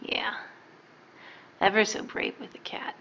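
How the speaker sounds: hushed encouraging whisper; whispered praise. Real, not acted. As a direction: breathe the praise without voice. Praising child near cat.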